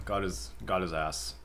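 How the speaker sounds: usual speaking voice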